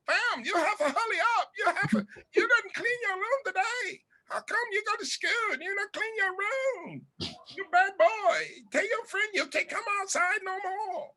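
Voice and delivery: high scolding mom impression; imitating a friend's mom. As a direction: scold like a strict immigrant mom. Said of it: "that's how your mama talk".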